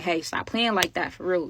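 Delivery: ordinary talk, nothing marked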